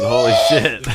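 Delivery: real amazed yelp, pitch leaping way up; excited shout. Real, not acted. Friend stunned by a perfect apple split, shouting in amazement. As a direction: blurt it out, truly stunned.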